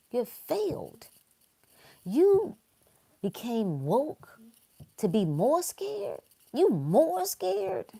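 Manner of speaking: high, slow incredulous mock; mock disbelief. Real, not acted.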